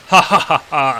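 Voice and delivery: loud villainous cackle; Lucifer's laugh. Acted. Lucifer greeting the new arrivals, acted evil laugh. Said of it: "And Lucifer laughs".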